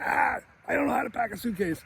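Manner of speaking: put-on high-pitched whining kid in a mild panic; kid Kevin. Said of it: "And Kevin's like".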